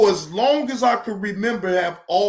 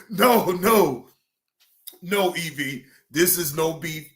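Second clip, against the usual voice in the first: ordinary talk, nothing marked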